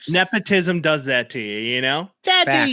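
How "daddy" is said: put-on squeaky high pleading kid voice; high falsetto begging; whiny son voice. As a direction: beg Daddy like a whiny boy. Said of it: "sound like a British fourteen-year-old".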